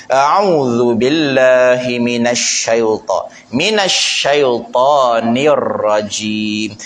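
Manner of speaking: "a'udzu billahi minasyaitonir rajim" very slowly, with a clear dh; slow recitation. Shown as a demonstration. Ustaz recites the ta'awuz for the congregation to repeat, slowly. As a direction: recite it slowly and carefully for copying. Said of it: "pastikan zu".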